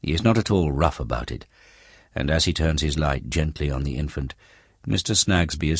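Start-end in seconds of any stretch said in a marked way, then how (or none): none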